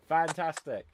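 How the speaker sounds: ordinary talk, nothing marked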